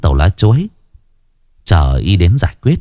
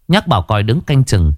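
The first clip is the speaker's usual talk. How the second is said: ordinary delivery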